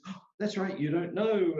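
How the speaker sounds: friend's realization. Retold or imitated.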